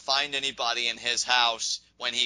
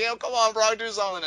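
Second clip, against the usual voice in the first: higher-pitched pleading egging-on; mock urging; 7 semitones higher than usual. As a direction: urge a fighter to act, voice pitched up. Acting out his own urging thought.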